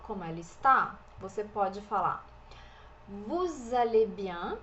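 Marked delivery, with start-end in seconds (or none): 3.1-4.6 Vous allez bien said very slowly, the liaison voiced as Z; slow French demo. Shown as a demonstration. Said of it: "Aqui a gente tem o vous". (Vous allez bien bem devagar, com liaison em Z)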